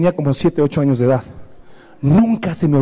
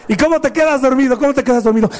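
scolding mother; 8 semitones higher than usual. Retold or imitated. Mother scolding child, voiced high.

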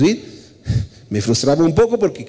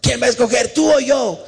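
mild mock yell, higher pitch; imitando gritos de hijos; 9 semitones higher than usual. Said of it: "que le gritan a sus papás". (grito fingido de hijo rebelde)

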